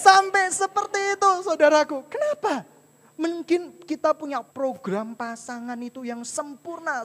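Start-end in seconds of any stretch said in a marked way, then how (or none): none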